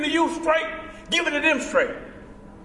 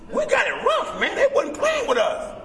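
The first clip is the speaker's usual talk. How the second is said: normal talking voice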